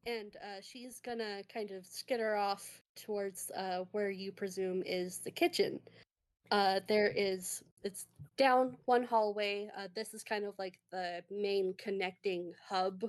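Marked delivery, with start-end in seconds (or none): none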